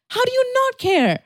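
high, loud disbelief; a big high-pitched baffled question; incredulous squeal. Retold or imitated. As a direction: squeak it out as if that's impossible. Incredulous reply to friend.

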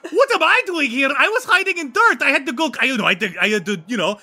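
put-on high-pitched accented vampire voice, stumbling over words; hammed-up flustered vampire. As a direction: explain yourself in an excited muddle. Ivan explains himself.

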